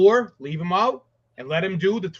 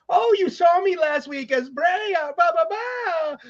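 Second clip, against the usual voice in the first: very high, googly character voice; mock-gleeful high-pitched impression, drawing out the end; Bray Wyatt; 11 semitones higher than usual. Pitching a storyline to co-host, high googly wrestler voice. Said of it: "Bray Wyatt comes out as the googly".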